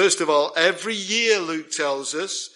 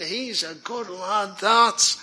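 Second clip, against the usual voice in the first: townsfolk voice; 3 semitones higher, slower than usual. Retold or imitated.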